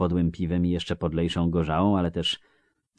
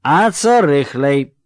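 loud, higher, slurring tipsy villager; drunk Melfi; 9 semitones higher, 13 dB louder, much slower than usual. Acted. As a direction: call out like a cheery drunk. Melfi asks. (pijany Melfi, głośno i bełkotliwie)

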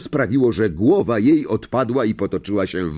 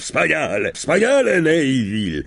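exclaimed congratulations, second one much higher; excited praise; 4 semitones higher than usual. A narrator's reading. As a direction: cheer the pupil with rising delight. Teacher cheering the winning pupil.

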